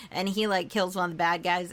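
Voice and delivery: ordinary talk, nothing marked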